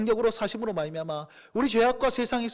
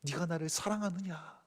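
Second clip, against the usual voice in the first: gentle question; 4 semitones lower, 9 dB quieter than usual. Acted. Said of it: "그 주님 물어보십니다".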